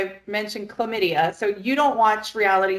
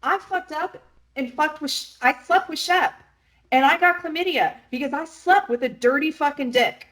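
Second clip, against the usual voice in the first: blunt owning it; 6 semitones higher than usual. Pretend. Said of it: "Why not say".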